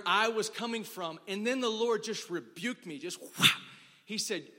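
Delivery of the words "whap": loud, sudden "whap"; explosive impact sound effect; imitated smack. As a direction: punch out a loud smack noise. Imitates sudden rebuke striking him.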